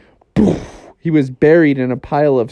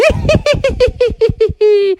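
evil laugh. Acted. Snow woman laughing evilly.

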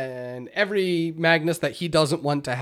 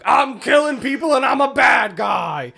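hammed-up, higher-pitched bad guy shouting; mock villain; 8 semitones higher, 3 dB louder than usual. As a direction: parody a game boss's silly voice line. Mocking the boss's villain voice lines.

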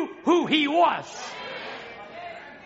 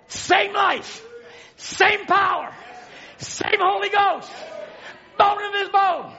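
fiery preaching; 6 semitones higher than usual. Acted. Preacher rousing the congregation, shouting with full force.

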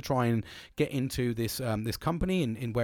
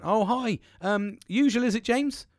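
high-pitched, welcoming barmaid voice; high sing-out welcome; imitated friendly barmaid; 10 semitones higher, 3 dB louder, slower than usual. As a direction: mimic a barmaid greeting her regular, pitched right up. Acts out barmaid greeting a regular.

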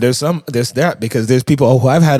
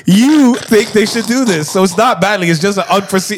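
hammed-up soothing counselor; mock counselor voice; 7 semitones higher, 4 dB louder than usual. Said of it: "mock earnest counselor voice".

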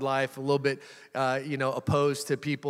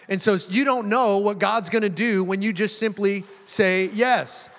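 raised, ringing sermon voice; fervent preaching; 4 semitones higher, 3 dB louder than usual. Acted. Preacher urging the church to say yes to God. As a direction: drive the point home to the congregation.

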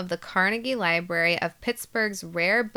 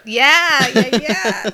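laughing; 5 semitones higher, 10 dB louder, much slower than usual. Real, not acted.

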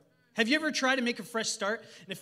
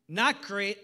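ordinary delivery

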